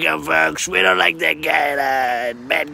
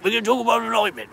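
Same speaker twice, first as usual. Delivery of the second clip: normal talking voice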